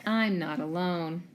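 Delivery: usual speaking voice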